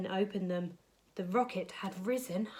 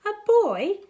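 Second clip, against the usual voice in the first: surprised repeat; 10 semitones higher, 11 dB louder than usual. Acted. Creature hears about a boy.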